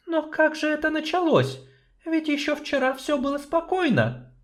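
high, measured female voice; doubtful sorceress. Acted. Sorceress doubting the dwarf's plan, high and measured.

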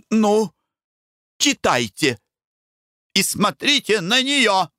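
high, challenging cry; bitter exclamation. A narrator's reading. Blanche cries out to Foker, pointing at her mother, high. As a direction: throw it at him. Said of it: "воскликнула Бланш".

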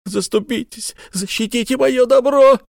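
very high, rising into a loud plea; pleading cry. A narrator's reading. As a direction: beg the governor in panic. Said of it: "воскликнул Исаак Хакабут".

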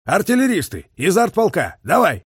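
urgent artillery command; called command. A narrator's reading. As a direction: call the gunners into action. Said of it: "подал команду".

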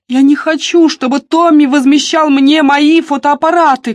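high, loud, upset cry; shouting. A narrator's reading. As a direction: shout it in protest. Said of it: "крикнула Дебора".

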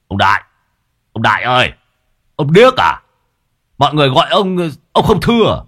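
calling out. Acted. Boy calling the old man.

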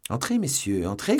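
loud welcoming call. A narrator's reading. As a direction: call the guards in briskly. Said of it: "cria D'Artagnan".

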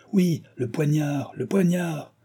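high, drawn-out crowd demand; slow, insistent narrated cry. A narrator's reading. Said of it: "reprirent les mêmes voix".

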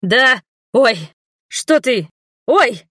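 put-on old man dodging the nun's scroll, yelping. A narrator's reading. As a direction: squeal as the blows land. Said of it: "пытался увернуться Ульрих".

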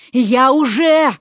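slow, drawn-out indignant cry; exasperated protest. A narrator's reading. Vasya protests his promise of princesshood, exasperated. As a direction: stretch it out in frustration. Frog protests marriage offer.

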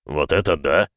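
low, husky astonishment; mildly hoarse creature voice; hoarse wheeze. A narrator's reading. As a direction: wheeze it out in amazement. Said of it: "просипел Крайон".

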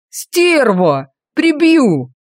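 rising into a furious yell; furious villain. A narrator's reading. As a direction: snarl then yell the threat. Villain hit in nose.

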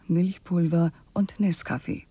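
ordinary talk

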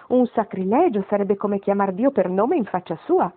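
ordinary delivery, nothing marked

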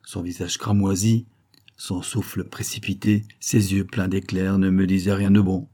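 plain reading voice

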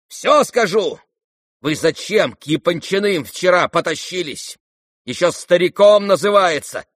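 high, loud reproach; scornful tirade. Acted. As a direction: answer the curse with angry scorn. Son rails back at cursing father.

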